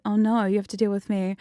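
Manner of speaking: ordinary talk, nothing marked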